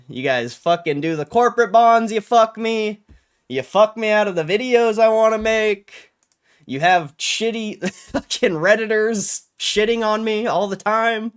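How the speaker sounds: angry rant. Real, not acted.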